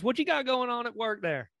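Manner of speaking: high-pitched North Carolina family drawl; Southern drawl. Retold or imitated. Chatting with family back home, Southern drawl. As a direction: put on a down-home Southern accent. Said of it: "talking to each other like this".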